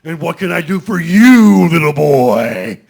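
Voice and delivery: big loud Santa-style dragon growl; Santa Claus dragon voice. Retold or imitated. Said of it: "he goes".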